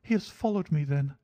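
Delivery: very high, anxious feminine voice; alarmed lady client. Acted. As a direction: ask in high-pitched alarm. Frightened client learns she was followed.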